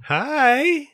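very high sing-song hello; voice up octaves. Real, not acted. Host says hello to friend's daughter, high cooing voice. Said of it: "my voice just went up a couple octaves".

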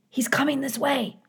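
put-on high-pitched urgent child voice; alarmed young gosling. A narrator's reading. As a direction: warn mother in a small high voice. Young goose warns mother of hunter.

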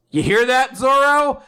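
calling out to Zoro. Retold or imitated. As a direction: shout it over to Zoro.

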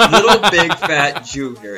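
laughing. Real, not acted. Relishing the silly name.

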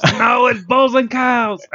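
high, slow, loud mock insistence; mock shout. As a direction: bellow the correction in jest. Joking correction, mock insistence.

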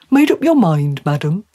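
polite fish prompting a decision; inquiring trout, rising on the last word; courteous talking trout. Acted.

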